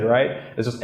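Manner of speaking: usual speaking voice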